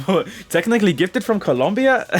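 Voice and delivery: laughing through a light mock Mexican Spanish accent; Colombia said with a giggly Mexican Spanish lilt. Laughing at his own Colombian player intro, mock Mexican Spanish accent. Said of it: "that's more like a Mexican Spanish, accent".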